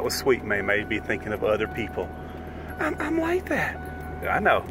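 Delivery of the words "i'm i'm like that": high voiced dog complaining; dog's voice. Owner voicing Martini the dog answering, high and squeaky.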